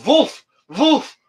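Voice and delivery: put-on a laughing, high-pitched German accent barking "Wolf, wolf" as Vulf, vulf; German-accented dog bark. Joking with chat about Sam's German Shepherd, laughing German-accented bark. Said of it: "Knew her German Shepherd had an accent".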